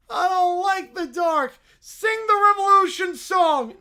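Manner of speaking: a frightened little bear whimpering; scared kid voice. Acted. Bear in the dark cell asking for a song.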